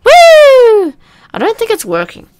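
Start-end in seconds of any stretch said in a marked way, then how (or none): 0.0-0.9 long, very high, loud whoop; demo scream. Shown as a demonstration. Player showing the scream the game might want, piercingly high whoop. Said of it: "have to scream right now".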